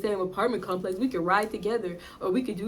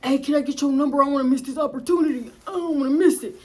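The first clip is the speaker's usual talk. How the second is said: higher, eager mock dude voice; imitating the guy; 4 semitones higher than usual. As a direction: play him pushy and eager, voice pitched up.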